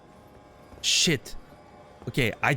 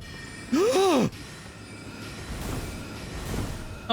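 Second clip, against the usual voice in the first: startled sharp gasp. Real, not acted. Sudden enemy appears.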